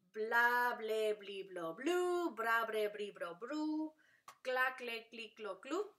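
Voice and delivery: each cluster like "bra", "cla" as one unbroken beat; "bla", "bra", "cla" series chanted evenly for learners; syllable drill. Shown as a demonstration. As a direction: recite "bla, ble, bli, blo, blu" as a rhythmic vowel run. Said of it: "they make the sound".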